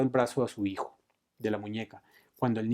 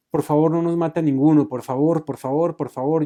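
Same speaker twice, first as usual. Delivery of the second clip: louder, higher repeated begging; tearful child's plea; 5 semitones higher, 10 dB louder than usual. A narrator's reading. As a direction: plead urgently like a crying boy. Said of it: "lloró el niño".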